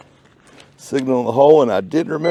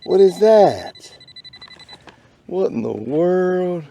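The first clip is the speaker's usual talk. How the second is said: puzzled surprise; 6 semitones higher, slower than usual. Real, not acted. Unearths strange metal tag.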